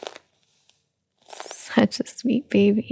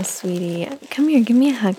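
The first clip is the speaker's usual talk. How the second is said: ordinary delivery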